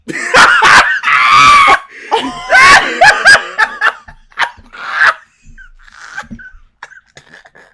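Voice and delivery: high shrieking burst of laughter; loud shrieking laugh. Real, not acted. Laughs at keep the baby.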